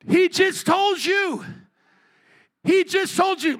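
high, loud comic exasperation; exasperated. Real, not acted. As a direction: throw it out as an incredulous retort. Preacher mock-exasperated at their question.

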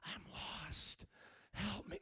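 hushed, mock-helpless whisper; soft, voiceless self-pity, put on; whispering to yourself. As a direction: whisper it low, as a feeble plea. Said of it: "You don't have to whisper to yourself".

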